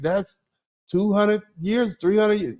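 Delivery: ordinary talk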